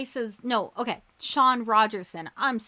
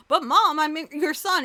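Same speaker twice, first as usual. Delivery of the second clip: higher, louder whining plea; whiny son; 6 semitones higher, 5 dB louder than usual. Retold or imitated. Officer Jackson pleading with his mom, in a whiny voice. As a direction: play the hurt grown child.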